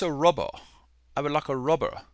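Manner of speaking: soft put-on British accent; British imitation with dropped final r. Shown as a demonstration. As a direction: ask in amateur British English. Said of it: "give you my British accent".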